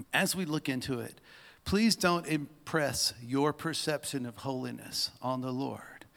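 normal talking voice

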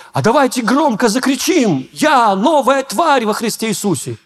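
high, upbeat preacher call to shout; rally the class, lightly voiced pastor; pastor's rallying call. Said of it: "пастор Андрей у нас говорит".

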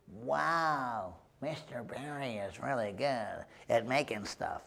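soft, low, drawn-in wonder; awed schoolboy. Retold or imitated. Sixth grade boy watching the pottery teacher, hushed awe. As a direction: marvel quietly like an impressed kid.

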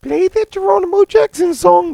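very high, loud put-on voice; mock character voice. Imitating a character asking for music, squeaky mock voice. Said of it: "That's what one of them says".

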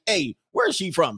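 ordinary talk

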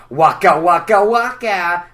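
put-on the old take of the waka; old Fozzie waka. Shown as a demonstration. Fozzie's catchphrase, first version. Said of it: "here was my old waka waka".

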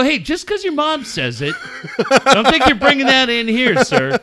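loud, high reprimand through laughter; mock scolding. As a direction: lay down the law, half laughing. Retold telling-off of swearing son.

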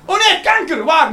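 high, loud fake alarm; mock panic. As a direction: shriek as if it's right there. Mock panic about cancer. (gespeelde paniek, hoog en luid)

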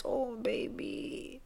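slow, soft pitying sigh of a line; hushed, slow oh baby. Acted. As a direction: coo it with sympathy. Pitying reaction to news.

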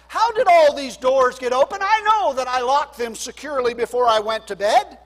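startled jailer. Acted. Preacher voicing the jailer's alarm at open doors.

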